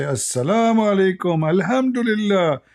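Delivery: mock greeting. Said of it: "and say".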